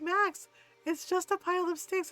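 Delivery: high-pitched mock reassurance; imitated rider. Rider tells Max it's just sticks, imitated reassurance. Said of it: "She's like".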